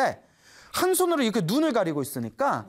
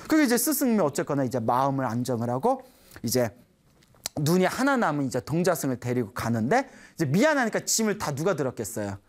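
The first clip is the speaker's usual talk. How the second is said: ordinary talk, nothing marked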